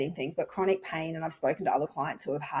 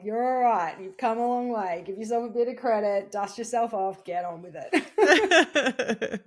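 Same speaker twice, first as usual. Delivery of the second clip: tough-love pep talk; 5 semitones higher, 4 dB louder than usual. Retold or imitated. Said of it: "really just like".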